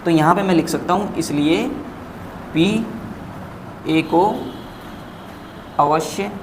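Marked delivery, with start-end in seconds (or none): 1.2-6.3 one word at a time, long gaps; dictating while writing. Real, not acted. Teacher writing the conclusion for students, slow halting dictation.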